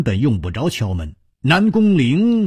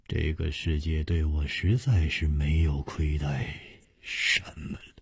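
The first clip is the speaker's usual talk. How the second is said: low, very soft murmur to himself; contented murmur; 10 semitones lower, 10 dB quieter than usual. A narrator's reading. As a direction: murmur it low, trailing into near silence. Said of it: "喃喃地说". (心满意足地低声喃喃自语)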